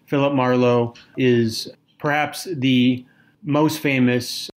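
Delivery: ordinary talk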